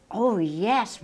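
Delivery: ordinary delivery, nothing marked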